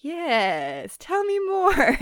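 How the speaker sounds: high, drawn-out gleeful egging-on; playfully acted relish, pitch leaping up; mock eager interest. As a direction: stretch it out, hungry for gossip. Acts out Colin egging her on.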